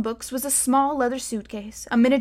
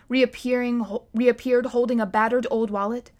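ordinary delivery, nothing marked

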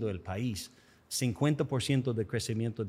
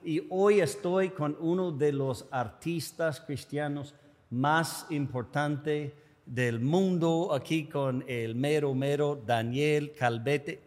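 show host intro; 3 semitones higher than usual. Acted. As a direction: hype up the guest like a radio announcer.

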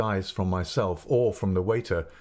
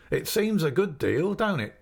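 lightly voiced waiter, pitched up and sly; a friendly innkeeper's man coaxing the boy; chatty waiter; 7 semitones higher than usual. A narrator's reading. Waiter at the inn teasing the boy about the ale, lightly voiced. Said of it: "he said".

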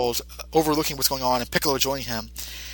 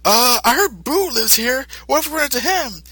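nervous thug; 9 semitones higher than usual. Retold or imitated. Mustache gunman worrying to his partner, in a much higher nervous voice. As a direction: sound like a jumpy gunman. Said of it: "the mustache guy says".

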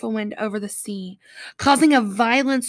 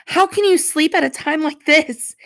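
loud, raised captain's shout; shouted rebuke; 6 semitones higher, 9 dB louder than usual. A narrator's reading. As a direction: shout it at a sleeper, high and urgent. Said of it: "he shouted".

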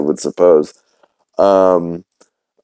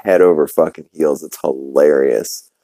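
ordinary talk, nothing marked

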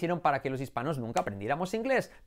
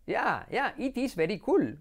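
higher-pitched cartoon Indian accent; Apu; 5 semitones higher, slower than usual. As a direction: put on an Indian-English lilt, "cool" as KOOL. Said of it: "Como dice Apu". (acento indio agudo de dibujos)

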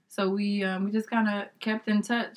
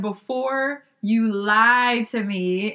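slow, stretched out; drawn out; 2 semitones higher, 5 dB louder, much slower than usual. Retold or imitated. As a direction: stretch every word slowly, teasing. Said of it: "she had it drawn out".

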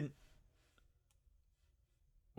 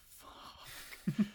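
whispered curse; 8 semitones higher, 6 dB quieter than usual. Retold or imitated. Reaction after hitting sign.

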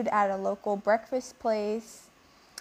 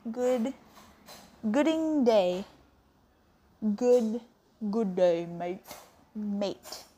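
fumbling practice of Aussie vowels; attempted Australian accent; slower than usual. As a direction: rehearse an Australian accent word by word. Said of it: "Did I sound Australian enough?".

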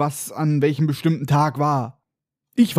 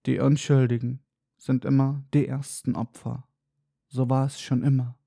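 soft, portentous centaur; mysterious and profound; 3 semitones lower, 6 dB quieter than usual. A narrator's reading. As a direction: intone it softly like a cryptic oracle. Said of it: "antwortet nur ganz mysteriös und tiefgründig".

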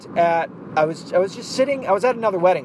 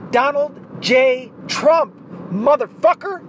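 excited reveal; 9 semitones higher, 4 dB louder, slower than usual. Real, not acted. Reading the tie tag excitedly.

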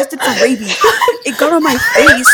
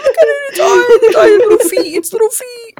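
high, wailing mock sobs; hammed-up hysterical blubber, words tumbling out in a squeal; crying in terror; 11 semitones higher, 3 dB louder than usual. Scared child pleading with uncle about possum's little feet, high crying panic. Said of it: "fucking crying like a little bitch".

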